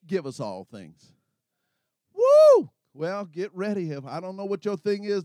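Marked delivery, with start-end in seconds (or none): 2.1-2.7 high, loud preacher's whoop; whooping with holy joy; ecstatic whoop. Real, not acted. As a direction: let out a joyful shout.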